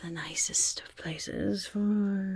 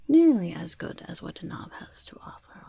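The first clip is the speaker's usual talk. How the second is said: ordinary talk, nothing marked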